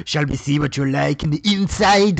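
imitation of a muddled Indian-to-Russian accent; mock wandering accent. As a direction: put on his wandering foreign accent, loud on the end. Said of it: "now you're Russian".

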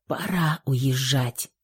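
soft, slow hushed words; whispered. A narrator's reading. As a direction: breathe it out quietly, resigned. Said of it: "прошептала Юлия".

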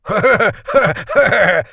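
slow, loud hammy evil laugh; a rehearsed villain's cackle; villainous laugh. Acted. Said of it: "захохотал крысолов номер два".

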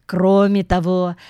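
usual speaking voice

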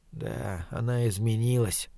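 very soft, low musing; inward thought. A narrator's reading. Dying man notes her change, thinking to himself. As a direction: say it under your breath, to yourself. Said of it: "подумал он".

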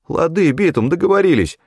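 high, eager crony voice; hasty agreement. A narrator's reading. Федька rushing to accept the hunter's offer, high and eager. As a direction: agree hastily, pitched well up. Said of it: "глотая слова от спешки".

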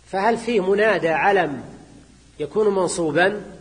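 normal talking voice

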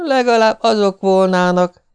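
high, put-on wifely reproach; wife's retort. A narrator's reading.

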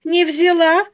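high, loud burst of glee; joyful exclamation. A narrator's reading. Wife delighted the package was refused, gleeful cry. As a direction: crow it in triumph. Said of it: "радостно засуетилась жена".

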